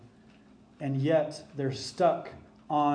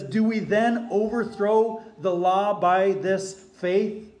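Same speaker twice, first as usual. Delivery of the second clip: slow, raised questioning voice of Paul; voicing Paul; 4 semitones higher, 5 dB louder, slower than usual. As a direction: read Paul's question higher and slower. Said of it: "He says".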